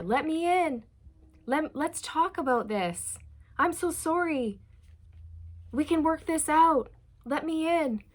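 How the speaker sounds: high, desperate begging at the door; pleading, desperate. Retold or imitated. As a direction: beg to be let in, frantic. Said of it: "it's very, very pleading, desperate".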